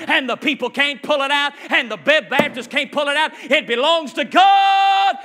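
rising preacher's cry, building to a shout; preaching. Acted. Preacher building to a shout before the congregation.

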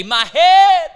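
put-on high, loud cry of a child in pain; a child's shout of pain; screaming in pain. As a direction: scream it out like a hurting boy. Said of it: "he screams to his daddy".

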